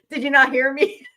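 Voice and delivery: ordinary talk, nothing marked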